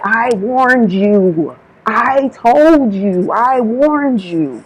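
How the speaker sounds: "warned" and "told" leaned on, very slow; slow stressed warning. Retold or imitated. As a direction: spell out each word like a final warning. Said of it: "He's stressing this".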